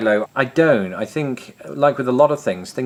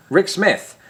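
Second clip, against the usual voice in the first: normal talking voice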